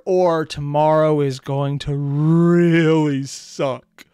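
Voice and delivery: very slow, loud, drawn-out words; emphatic dread. Real, not acted. Tired viewer explaining the early morning, slow emphatic dread. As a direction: stretch it out heavily, leaning loud on the key word.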